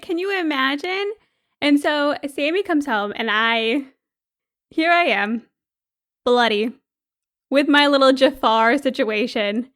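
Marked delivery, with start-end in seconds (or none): none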